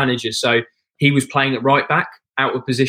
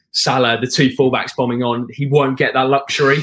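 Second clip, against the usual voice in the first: ordinary delivery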